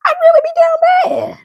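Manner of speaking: high-pitched, hammed-up wail; mock crying. Joking about being down bad.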